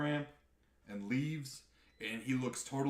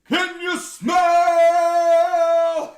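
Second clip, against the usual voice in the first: booming, drawn-out, sung catchphrase, much higher and louder; The Rock's catchphrase; 18 dB louder, much slower than usual. Retold or imitated. Crowd hears The Rock's return, booming sung call. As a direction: belt it like an arena entrance call, very slowly. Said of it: "And then you hear".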